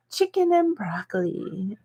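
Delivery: whispered, savoring the words. Real, not acted. Repeating her favorite order to viewers, whispered. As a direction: breathe it like a secret craving.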